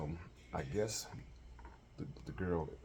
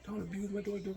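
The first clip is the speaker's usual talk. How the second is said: mock cussing mama. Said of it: "She start cussing me like".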